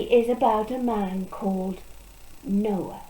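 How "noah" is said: ordinary delivery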